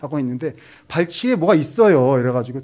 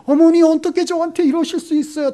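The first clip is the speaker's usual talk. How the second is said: very high, loud falsetto whimper; daughter-in-law pleading with her mother-in-law in mock tears; mock tearful plea; 7 dB louder than usual. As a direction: whine it like a teary daughter-in-law. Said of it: "눈물요".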